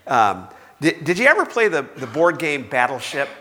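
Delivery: ordinary talk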